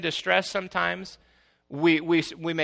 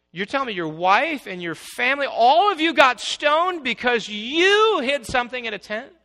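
high, loud incredulous exclaim; mock disbelief; 9 semitones higher, 7 dB louder than usual. Pastor reacting to Achan's family being stoned, high and loud. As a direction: gasp at the absurdity.

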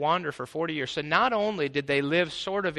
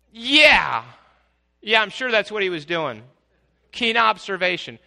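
sarcastic; 5 semitones higher, 8 dB louder than usual. Acted. Sarcastic rebuttal to accusers.

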